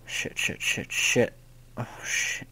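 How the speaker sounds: panicked whisper under the breath; whispered curses. Real, not acted. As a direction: hiss it out in panic.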